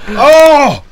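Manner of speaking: high, loud mock alarm turning to glee; excited outburst. Host suddenly remembering gifts for co-host, high excited cry. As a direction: cry out, stretching the last words.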